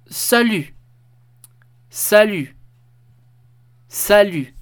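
"salut" en francés, con la u cerrada /y/ y la t muda. Shown as a demonstration. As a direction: repetir "salut" despacio y claro, como modelo. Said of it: "Y se pronuncia". (modelo de pronunciación de "salut", repetido)

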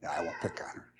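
a quick hushed joke aside; whispered aside. Real, not acted. Pastor whispering a teasing aside about Mike's wife.

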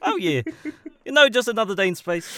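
casual in-character storytelling, lifting in pitch; Korg. Acted. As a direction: chat on as the easygoing rock creature. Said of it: "I wanna interview Korg so bad".

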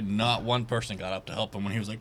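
ordinary talk

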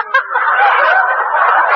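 high laugh. Acted. Laugh after song.

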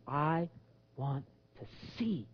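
slow, very soft, halting request; hushed plea. Acted. Blind man coming to Jesus, slow and hushed. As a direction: breathe each word out quietly.

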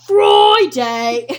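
mock British accent, building to loud; hammed-up British accent breaking into laughter. Listing the weekdays for a co-host, mock British accent. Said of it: "our renditions of British people".

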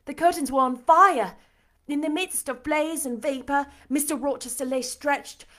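ordinary delivery, nothing marked

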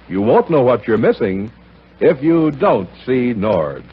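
ordinary delivery

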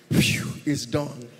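hammed-up sigh of done; mock relieved sigh. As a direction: drag it out, mocking the quick prayer. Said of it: "What is done?".

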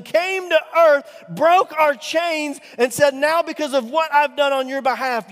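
loud, high, rising sermon climax; fiery pulpit crescendo, slowing on the big lines; full-force preaching. Acted. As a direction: preach it out, building to a shout.

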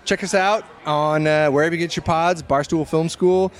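ordinary talk, nothing marked

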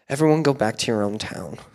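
low, subdued, weary dismissal; dejected. Acted. Preacher voices poor Samuel sending the Israelites home, dejected. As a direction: send them home with a defeated, sunken voice. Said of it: "I say it that way because ... He's so dejected".

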